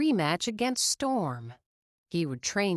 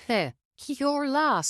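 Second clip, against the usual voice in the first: smug dismissive laugh; 8 semitones higher than usual. A narrator's reading. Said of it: "said Storm".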